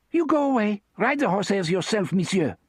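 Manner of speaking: put-on high, French-accented and emphatic; emphatic French woman. A narrator's reading. Therese sending Jake away, emphatic French accent. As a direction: shoo him off in a French accent. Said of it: "she said emphatically".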